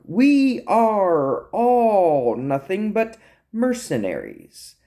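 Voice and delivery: high, drawn-out theatrical speech; hammy, swooping villain monologue; taunting captive monologuist. Acted. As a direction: lecture your captors with slow, mocking relish. Ralph's monologue resumes.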